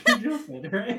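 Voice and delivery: loud, high words through laughter; cracking up in disbelief; real laugh-filled "you're joking". Real, not acted.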